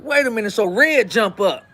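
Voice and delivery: ordinary talk, nothing marked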